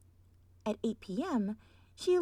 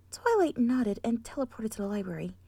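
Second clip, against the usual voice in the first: ordinary delivery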